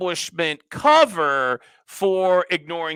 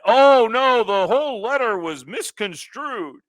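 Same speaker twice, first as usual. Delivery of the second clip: mock Clapper; 5 semitones higher, 6 dB louder than usual. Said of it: "and saying that".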